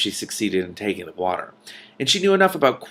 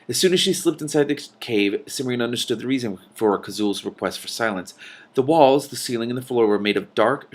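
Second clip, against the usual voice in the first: usual speaking voice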